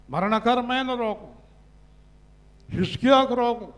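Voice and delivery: high pulpit cries with long pauses; emphatic preaching. Real, not acted. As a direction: proclaim each item, then let it hang. Preaching in short proclamations. (హెచ్చు స్వరంతో, ఆగి ఆగి ప్రసంగం)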